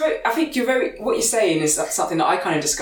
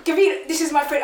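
put-on high, laughing Italian accent; 9 semitones higher than usual. Host copying a friendly introduction, Italian accent. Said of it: "say it in an Italian accent".

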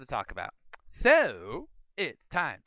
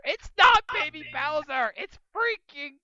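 high, drawn-out outraged shout; exasperated outburst; 12 semitones higher, 3 dB louder, slower than usual. Real, not acted. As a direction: stretch out each word in loud indignation. Exasperated correction of game name.

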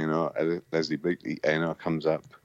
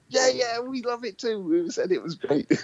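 high, fawning agreement; sycophantic band chorus. Retold or imitated. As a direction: gush along like suck-ups. Band voiced sucking up.

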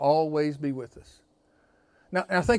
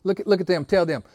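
normal talking voice

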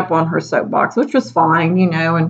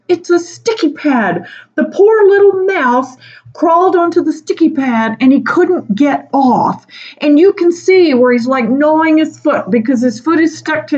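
much higher, pained mock voice; hammed-up horror over the mouse; high mock outrage; appalled colleague; 9 semitones higher than usual. Colleague describing mouse trap.

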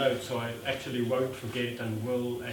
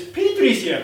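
put-on high, loud excited girl; 10 semitones higher, 7 dB louder than usual. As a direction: announce it, thrilled. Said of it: "and the girl says".

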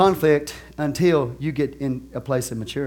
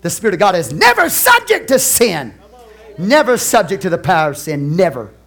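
loud, emphatic pulpit cry; full-force preacher's declaration; forceful preaching; 4 semitones higher, 8 dB louder than usual. Real, not acted. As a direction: hammer each "never" like a preacher.

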